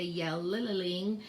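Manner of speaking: "yell-lil-ling" with the l repeated in extra syllables; wrong doubled sound. Shown as a demonstration. Teacher showing how not to say yelling, l sound repeated. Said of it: "we don't say".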